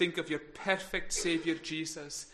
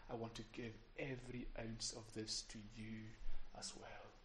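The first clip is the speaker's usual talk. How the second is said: tender Jesus voice; 6 semitones lower, 13 dB quieter than usual. Acted. Voicing Jesus offering his hand.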